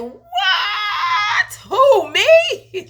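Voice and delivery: shouting high, laughing and crying at once; shocked disbelief. Retold or imitated. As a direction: squeal it in tearful disbelief. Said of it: "And I was like".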